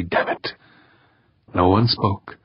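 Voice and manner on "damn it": whispered curse. A narrator's reading. Frustrated curse at silent radio.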